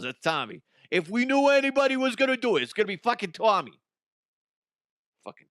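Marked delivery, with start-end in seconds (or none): 0.9-3.8 fast, high, loud exasperated rant; quick worked-up outburst; excited rant. Real, not acted. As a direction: rattle it off, fired up about Tommy. Excited rant about Tommy.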